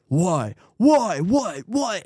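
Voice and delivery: retold anger. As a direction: imitate an agitated man demanding answers. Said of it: "he just got angry at me".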